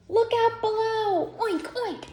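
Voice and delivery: high, loud piggy shout; squealing pig cannonball cry; excited pig call. Acted. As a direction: holler like a pig diving in. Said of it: "called Pig".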